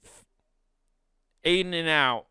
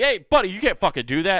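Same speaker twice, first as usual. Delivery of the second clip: stern call-out; 6 semitones higher, 8 dB louder, faster than usual. Pretend. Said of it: "somebody there to be like".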